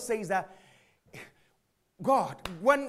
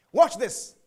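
ordinary talk, nothing marked

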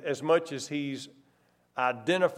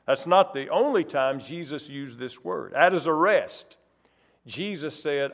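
ordinary delivery

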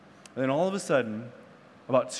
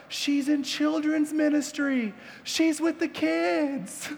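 high, slow, drawn-out mock yell; imitated distant yelling; 11 semitones higher, slower than usual. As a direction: call it out high without real volume. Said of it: "you hear him yelling out".

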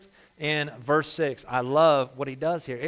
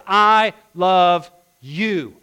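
slow and much louder, every word weighted; emphatic declaration; 3 semitones higher, 9 dB louder, much slower than usual. Pretend. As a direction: land the three words like a proclamation. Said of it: "he's saying these three words".

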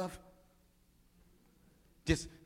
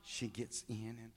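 ordinary talk

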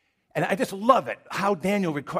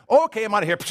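louder, higher, mock hasty exit; eager bail-out; 4 semitones higher, 6 dB louder, faster than usual. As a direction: blurt it out like someone bolting for the door. Acting out bailing on trouble.

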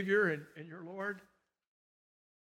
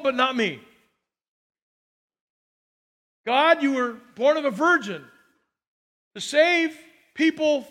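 louder, higher voiced lament to God; mock complaint to God; 5 semitones higher, 6 dB louder than usual. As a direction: throw his grievance at God, hammed up. Mock prayer voicing man's complaint.

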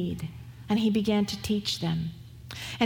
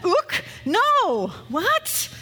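much higher, louder cartoonish "Ook, no, what?"; comic disbelief; 9 semitones higher, 4 dB louder, much slower than usual. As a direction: squawk it in mock shock. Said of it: "There's a lot more pushback there".

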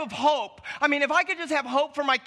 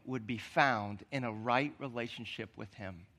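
hushed, slowed, intimate sermon voice; soft preaching; 12 semitones lower, 8 dB quieter than usual. Acted. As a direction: lean in and say it gently, almost under your breath. Soft reflective stretch of sermon.

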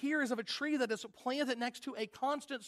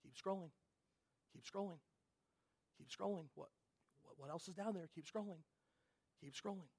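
soft, much quieter, a lulling coax; a voice luring you on, low and hushed; hushed tempting voice; 5 semitones lower, 15 dB quieter than usual. Acted. The pastor voices the inner pull to keep scrolling, as a soft, hypnotic murmur. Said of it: "that voice saying".

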